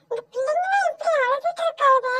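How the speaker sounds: playful dismay. Acted. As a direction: stretch the name out high, then comment on the criers. Classmate remarks on the crying children.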